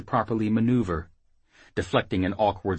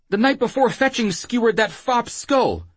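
high, louder anxious reply; frantic answer; 5 dB louder than usual. Acted. Whoremaster answering Jackal's interrogation, frantic. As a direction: blurt the answer out high and urgent.

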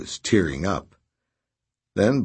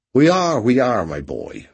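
put-on cheery old fence, higher and louder; cheerful; 5 semitones higher, 6 dB louder than usual. A narrator's reading. Raspy soothing Redrick, cheerfully. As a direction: jovially reassure the impatient young man. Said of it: "Raspy said cheerfully".

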